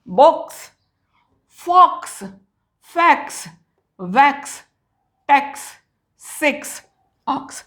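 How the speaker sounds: word list, each ending in a crisp "ks"; final KS sound. Shown as a demonstration. Teacher listing words ending in X, crisp final KS. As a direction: say each word alone, X as unvoiced k-s at the end. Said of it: "कुस".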